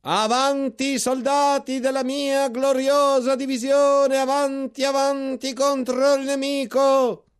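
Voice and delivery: high, loud, slow battle cry; rally the division with a harsh commanding yell; harsh shout. A narrator's reading. General ordering his troops forward, harsh high commanding shout. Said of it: "una voce aspra si levò".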